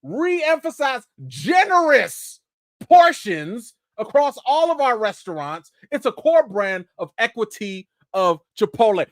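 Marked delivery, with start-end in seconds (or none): 0.0-3.7 "generous" drawn out slow and high; emphasized phrase. Shown as a demonstration. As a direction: stretch out "re-emphasize generous portions". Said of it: "Let me put that in green".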